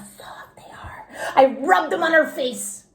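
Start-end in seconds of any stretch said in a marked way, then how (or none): 0.0-1.1 breathy eager whisper. A narrator's reading. Junie B whispering as she begs her teacher to feel the mittens. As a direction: hush it while pleading.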